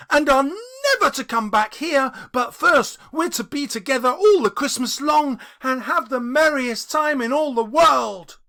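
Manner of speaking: put-on high, loud and bubbling little girl; gleeful child. Little Fan gleefully telling her brother he's going home. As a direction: burst in full of joy for her brother. Fan continues joyful speech.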